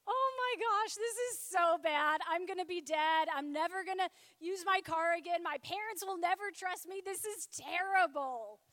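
put-on loud, frantic teenage panic near tears; panicked. Teen driver panicking to herself after fleeing dad, loud and frantic. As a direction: blurt it out like your life is over.

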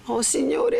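high, pained appeal to heaven; sorrowful exclamation. A narrator's reading. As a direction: lament with the voice lifted. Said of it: "esclamò dolorosamente Lucia".

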